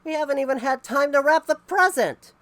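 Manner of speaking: high loud yell of a hurrying family; frantic family yelling. A narrator's reading. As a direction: wail it together, running late. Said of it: "came running down the road yelling".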